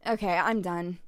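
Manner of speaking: usual speaking voice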